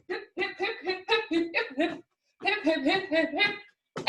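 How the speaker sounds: light, soft little "hip" on every hop; quick, quiet, rhythmic hops in the voice; hip-hip hopping noises; bouncy hopping sounds. Shown as a demonstration. Hopping around as a pencil.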